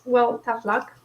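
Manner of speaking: normal talking voice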